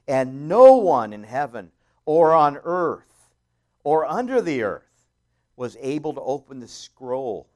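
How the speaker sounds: loud, slow pulpit reading; preaching at full force. Acted. Preacher reading to the congregation, full force. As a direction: thunder the verse to the church.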